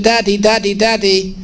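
put-on high, eager child voice; little boy pestering. Son pestering his tired father, high eager child voice. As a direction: play a small boy nagging his dad.

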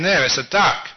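loud, triumphant proof from the wife; acted quarrel, emphatic. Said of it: "the wife raised her voice".

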